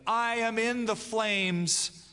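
slow, high, drawn-out cry of despair; anguished exclamation. Retold or imitated. Preacher voicing Hume's dying cry, slow anguished exclaim. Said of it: "he exclaimed".

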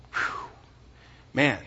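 ordinary talk, nothing marked

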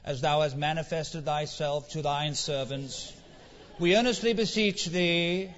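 a lightly hammed-up churchy prayer voice; mock pompous prayer. Preacher mocking stuffy prayers, lightly pompous. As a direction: parody stiff formal praying. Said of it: "It doesn't have to sound something like".

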